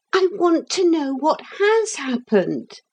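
put-on high, pressing boy's cry; insistent shout. A narrator's reading. Boy demands news in alarm.

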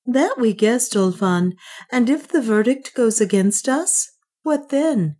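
a queen demanding the worst; Juana. Acted. Juana to Ulfan, pressing for the verdict.

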